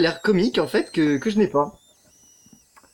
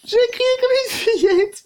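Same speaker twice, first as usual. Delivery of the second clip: mock high squeal on "J'ai crié comme une fillette"; imitating a girly scream. Gamer to viewers, replaying his scream in a high squeal. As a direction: replay the little-girl scream. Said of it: "Vous avez entendu mon cri?".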